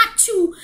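sneeze. Pretend. Sneeze in the story.

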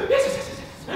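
high, voiceless whimpering; comic crying. Acted. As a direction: break into quick tearful squeaks. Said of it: "think I'm gonna cry right now".

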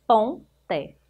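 "Ponte" as two parts, pon, pause, te; split into syllables. Shown as a demonstration. Teacher showing pupils the syllables of "Ponte", split apart. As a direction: break "Ponte" after "Pon" with a clear gap. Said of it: "Separando as sílabas".

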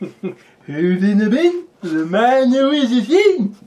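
high, slow, drawn-out showman call; gameshow host impression. Bruce Forsyth catchphrase impression.

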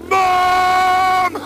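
yelling for mom, high; loud call. Acted. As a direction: call out across the yard. Calling out to mom.

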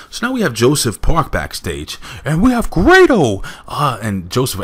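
a hammy, not-so-good imitation of Joseph Park. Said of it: "I'm trying to do a Joseph Park imitation".

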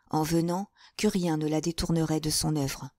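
plain reading voice

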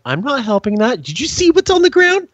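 high, disgusted protest; mock refusal. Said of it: "And I'm like".

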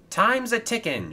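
high-pitched, lightly shouted call; eager shout. A narrator's reading. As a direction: call it out high to hurry the crew. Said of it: "shouted Kevin".

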